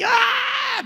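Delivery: sudden high cry of pain, retold. Preacher reliving his pain, a sharp high yelp. Retelling writhing in pain on carpet.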